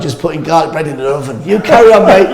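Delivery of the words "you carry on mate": high, loud call across a room; mock shout to the performer; pretend calling out. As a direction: call it out from behind the bar. Imagines calling out from the bar.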